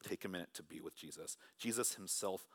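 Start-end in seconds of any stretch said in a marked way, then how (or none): 0.0-1.4 softly. Acted. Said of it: "Scripture says".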